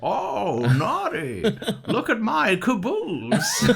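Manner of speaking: high, drawn-out lewd character voice through laughter; Justin Long impression. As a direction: ham up a naughty sex-scene voice, way up high.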